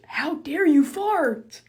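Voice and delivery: mock fury at a stranger who farted, slow and loud; mock rage. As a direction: stretch out the fury. Raging at someone who farted.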